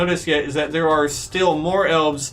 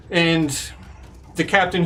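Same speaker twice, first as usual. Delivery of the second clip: usual speaking voice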